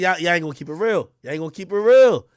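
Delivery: ordinary delivery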